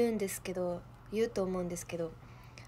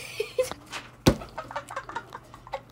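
breathy laugh. Real, not acted. Laughing at own choice.

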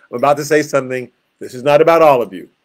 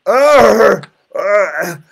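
imitated groaning; 6 semitones higher, 7 dB louder than usual. Shown as a demonstration. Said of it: "he's groaning in the".

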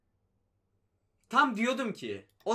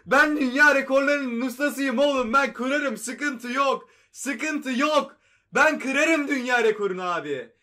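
loud, higher, laughing brag; triumphant boasting; 5 semitones higher, 8 dB louder, slower than usual. Real, not acted. Gamer bragging to viewers after breaking the record, triumphant. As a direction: crow about the win with a laugh.

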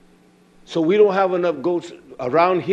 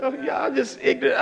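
softer, pleading prayer; acted plea to Yah; 4 semitones higher, 5 dB quieter than usual. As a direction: play a sinner begging forgiveness, hushed. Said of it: "fall at all and say".

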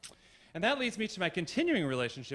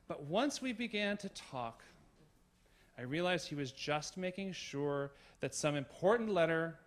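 usual speaking voice

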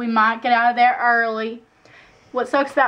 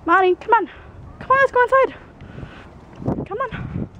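high, sing-song coaxing to the cat; pet talk; 8 semitones higher than usual. Real, not acted. Owner coaxing her cat and dog inside, high sing-song pet voice. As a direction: sweet-talk the kitty into coming in.

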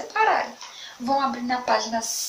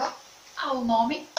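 ordinary talk

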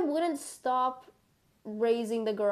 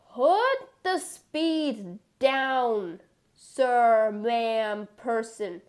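slow; 4 dB louder, much slower than usual. Acted. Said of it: "This is not fun".

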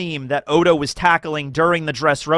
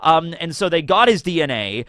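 ordinary talk

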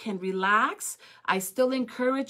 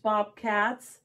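ordinary delivery, nothing marked

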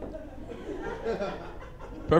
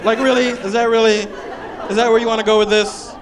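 incredulous; 7 semitones higher, 5 dB louder than usual. Real, not acted. Incredulous reaction.

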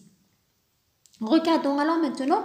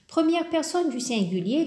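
usual speaking voice

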